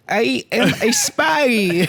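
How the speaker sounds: slow, high put-on Russian accent; Russian accent parody; mock Russian spy. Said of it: "Jennifer Lawrence going".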